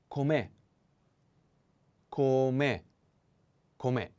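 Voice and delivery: "Com'è" with the stress on the final è. Shown as a demonstration. Teacher drilling "Com'è" for learners, stress on the final è. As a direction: land the weight on the last syllable of "Com'è". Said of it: "The accent is on the E".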